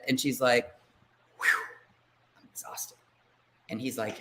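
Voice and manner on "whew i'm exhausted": hushed, voiceless sigh of tiredness; mock weary whisper after a big exhale; acted exhaustion. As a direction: act worn out, barely any voice left. Acts out weary mother at bedtime.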